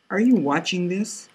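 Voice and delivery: lifted, hushed question. Acted. Narrator asking Vida on a side window, lifted and hushed. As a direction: ask it up high, a little hushed.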